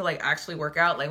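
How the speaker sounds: ordinary talk, nothing marked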